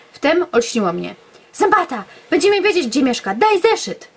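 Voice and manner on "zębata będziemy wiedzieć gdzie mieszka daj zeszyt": high, eager urgent calls; excited realization. Acted. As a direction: blurt it out, thrilled. Sudden idea, excited.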